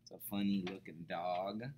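plain reading voice

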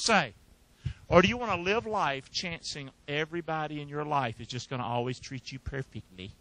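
normal talking voice

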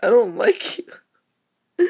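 slow, whispered whimper; a fake little sob at the game; whiny half-cry. Real, not acted. Gamer whimpering at a stubborn block.

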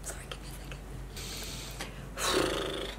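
near-whispered, choked apology; crying. Real, not acted. Asking viewers for a moment, tearful whisper. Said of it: "but I'm crying".

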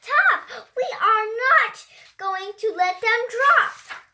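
high, lively storybook read-aloud; reading to children. Acted. A child reading a rhyming book aloud, high and animated. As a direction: bounce through the rhymes like a picture book.

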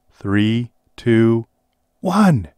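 countdown rising to a loud high "one!"; building countdown. Acted. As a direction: count down, climbing to the start shout. Countdown to race.

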